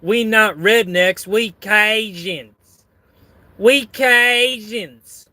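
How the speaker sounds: high, drawn-out Cajun retort; indignant mock Cajun accent; [name]. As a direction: stretch it out in a hammy Cajun accent. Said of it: "[name] retorts".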